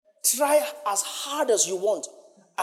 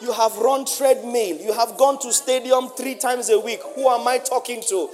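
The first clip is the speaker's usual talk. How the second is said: loud, slow, building preacher's list; rising sermon list, louder each line; preaching at full force; 4 semitones higher, 7 dB louder, slower than usual. Acted. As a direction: hammer each item home to the congregation.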